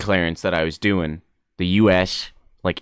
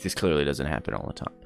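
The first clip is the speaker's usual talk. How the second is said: dry low mutter; 8 semitones lower, 10 dB quieter, faster than usual. Retold or imitated. Skeptical reply to staff.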